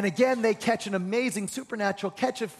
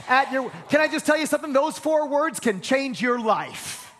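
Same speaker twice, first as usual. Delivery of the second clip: full-force preaching; 4 semitones higher than usual. Acted. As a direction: preach it with fire, building to a shout.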